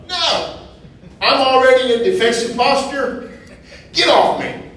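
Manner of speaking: indignant, high-pitched acted refusal; defensive protest. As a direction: push back hard as if being yelled at. Acts out defensive reaction to yelling.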